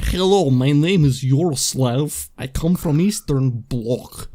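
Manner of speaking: slow, hammed-up Russian accent; heavy, drawn-out fake Russian accent. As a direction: introduce yourself as a mock Eastern Bloc Russian. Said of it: "talk with the Russian accent".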